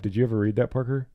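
normal talking voice

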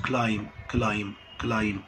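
كلايم said softly ending in m; كلايم: close on m, no b; silent b. Shown as a demonstration. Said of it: "الام هي اللي اتنطقت".

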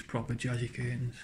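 ordinary talk

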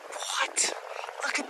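an unvoiced whispered gasp of a word; hushed disbelief; breathless whisper. Real, not acted. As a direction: whisper it, stunned. Stunned by the find.

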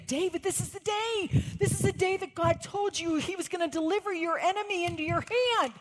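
high, loud jubilant cry to David; excited shouting. Retold or imitated. David's men urging him on in the cave, excited shouting. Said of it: "They were so excited".

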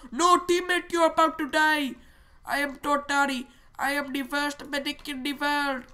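high, hammed-up accented medic; loud start, theatrical doomed medic; medic with put-on accent. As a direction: declaim it high in a mock foreign accent.

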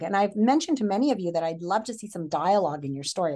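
ordinary delivery

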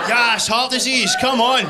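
loud, high mock celebration, drawn out; mock cheering. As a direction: cheer it on at full volume. Mock cheering over applause for fry-up.

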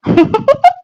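high mocking cackle. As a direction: cackle at the old pair. Mocking the old couple.

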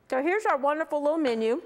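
talking with a mouth full of food; words round a mouthful. Real, not acted. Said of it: "Got my mouth full".

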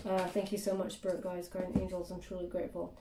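soft, murmured thanks to the spirit guides; quiet prayerful thank-you; hushed thanks. Real, not acted. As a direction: drop to a quiet, grateful murmur. Thanking spirit guides mid-reading.

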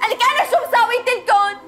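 desperate outcry. Acted. Maid's desperate outburst.

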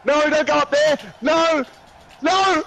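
desperate yelling. Real, not acted. Pleading at the game.

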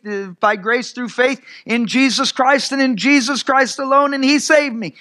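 soaring pulpit preaching; impassioned preaching. Acted. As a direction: lift the congregation with each line. Preacher climbing to a peak.

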